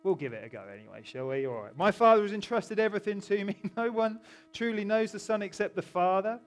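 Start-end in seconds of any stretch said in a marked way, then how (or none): 1.8-6.4 raised, louder declamatory Bible reading; scripture reading. Acted. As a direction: declaim the verse to the congregation, higher and louder. Reads scripture aloud.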